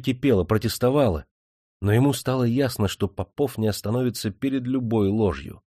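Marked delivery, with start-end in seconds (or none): none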